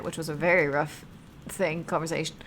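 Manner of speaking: ordinary delivery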